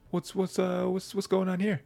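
high, mock-curious intruder; a sly bystander asking innocently; nosy Snake. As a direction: pop in high and nosy, lightly voiced. Snake butts in, acted quote.

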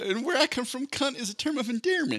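mock Southern lady. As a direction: play the offended Southern girl. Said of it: "She's like".

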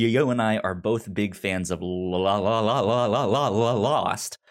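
ordinary talk

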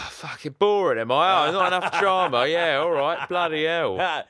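loud, high, hammed-up indignant rant; a put-on huff at being called boring; mock outrage. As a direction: play up being offended by the yawn. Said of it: "You and your visa issues, mate".

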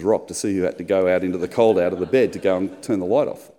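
ordinary delivery

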